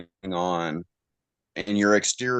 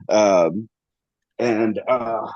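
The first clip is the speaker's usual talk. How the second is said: emotional; 3 semitones higher, 4 dB louder, much slower than usual. Real, not acted. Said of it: "I'm getting a little emotional".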